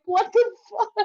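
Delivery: very high, drawn-out incredulous squeal; hammed-up shock; mock disbelief. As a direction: imitate baffled onlookers in a slow falsetto. Onlookers' disbelief, acted.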